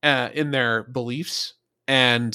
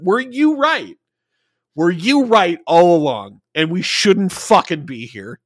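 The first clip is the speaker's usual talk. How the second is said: higher-pitched, punching "Were you right"; emphatic acted question; 5 semitones higher, 4 dB louder than usual. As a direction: press "you right all along" high and hard. Acting Archer's incredulous question.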